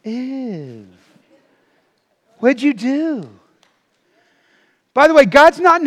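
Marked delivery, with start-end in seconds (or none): 0.0-3.3 drawn-out mock disgust, softly put on. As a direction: recoil as if appalled by them. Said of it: "God's looking at you going".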